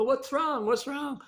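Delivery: high, loud startled cry; startled alarm. Retold or imitated. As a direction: jolt awake and call out in alarm. Jumps up from the couch.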